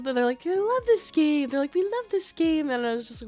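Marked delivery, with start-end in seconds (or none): 0.5-1.5 high, drawn-out fairy voice; lilting mock-fairy delight; gleeful fairy. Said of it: "they're, like, laughing and giggling". 1.7-2.7 high, lilting fairy voice; imitated fairy delight; gleeful fairy. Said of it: "they're, like, laughing and giggling".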